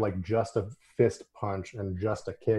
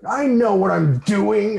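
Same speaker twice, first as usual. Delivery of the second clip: high, loud, drawn-out boast; puffed-up imitation of overconfidence; mock know-it-all; 11 semitones higher, 10 dB louder, slower than usual. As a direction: crow it slowly, cocksure. Mocking overconfident adults' line.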